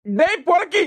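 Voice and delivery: furious yell, high and loud; angry shout. Acted. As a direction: bellow the insult at him in rage. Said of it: "அன்பு எதுக்கு இவ்ளோ கோவமா வரான்?". (கோபமான கத்தல்)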